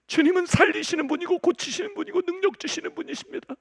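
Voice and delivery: high, loud pleading prayer; loud impassioned prayer; fervent prayer. Retold or imitated. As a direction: cry out to God in earnest.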